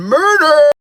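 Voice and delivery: drawn-out, high, loud cry on the last word; outraged yell. Real, not acted. Accusing the cop who held him down, outraged yell. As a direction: let the word burst out and ring.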